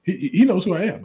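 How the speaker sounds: high, thrilled bragging; excited hype man. Retold or imitated. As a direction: crow about being recognized. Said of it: "It's like".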